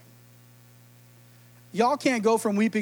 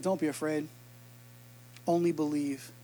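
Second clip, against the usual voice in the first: 1 soft gentle reassurance; 7 semitones lower, 11 dB quieter than usual. Acted. Jesus comforting the synagogue leader.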